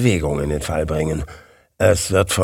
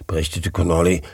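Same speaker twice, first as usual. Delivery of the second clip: usual speaking voice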